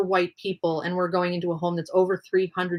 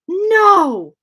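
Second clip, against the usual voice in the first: shocked gasp; 13 semitones higher, 9 dB louder than usual. Real, not acted. Audio cuts out on stream.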